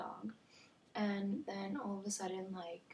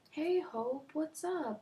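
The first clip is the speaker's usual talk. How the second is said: higher, louder breezy hello; mock friendly greeting; 7 semitones higher, 4 dB louder, slower than usual. Neighbor greets little girl playing outside, mock friendly. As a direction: imitate a chummy neighbor calling over.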